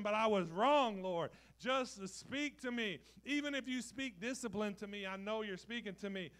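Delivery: loud, high, frantic apology to the Lord; nervous, eager yes-sir pleading; cowering servant. Retold or imitated. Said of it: "you're like".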